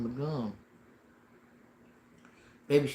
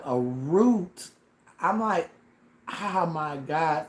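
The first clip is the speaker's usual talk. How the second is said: higher, slow panicked gasp of disbelief; 4 semitones higher, 6 dB louder, much slower than usual. Retold or imitated. Patient panicking at the news of a root, higher and slow. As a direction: replay the panic at the dentist. Now I'm really panicking.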